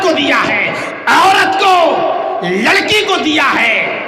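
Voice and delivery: loud, high sermon, hammering each point; fiery preaching. Acted. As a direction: preach it hard to the crowd. Preaching at full force. (پرجوش خطابت)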